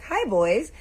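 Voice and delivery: high, loud singsong hello; breezy greeting. Retold or imitated. Auntie approaching huddled boys, high casual greeting. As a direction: call it out to a group, too casually.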